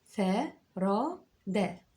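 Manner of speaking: "فرادة" very slow, each syllable stretched; said slowly. Shown as a demonstration. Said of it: "هقولها بشويش".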